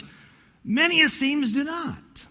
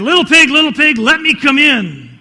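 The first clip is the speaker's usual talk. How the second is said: loud, higher storybook wolf call; big bad wolf; 7 semitones higher, 12 dB louder than usual. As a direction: call out loudly like the wolf at the door. Wolf calls at the door.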